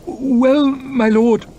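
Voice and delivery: high, hesitant stammering aide; nervous secretary. Acted. Secretary nervously pleading the farmer's case to his lord. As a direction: tread carefully, unsure. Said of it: "muttered Morrow".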